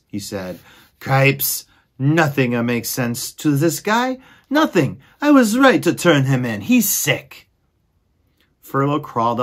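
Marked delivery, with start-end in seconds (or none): 1.0-7.5 higher, louder exasperated squeaky mouse voice; exasperated mouse brother. Acted. Furlow scolding his dreamy brother, exasperated and higher. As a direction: huff it out as the fed-up brother.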